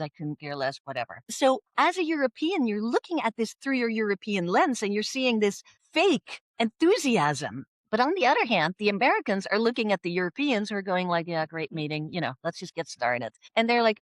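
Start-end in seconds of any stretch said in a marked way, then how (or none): 0.0-1.2 low, soft, dismissive mutter; imitating total indifference; uninterested. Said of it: "it basically means you're not interested". 11.3-13.3 low, soft, unimpressed European; imitating an underwhelmed colleague, pitch sinking; no enthusiasm. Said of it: "they're showing no enthusiasm".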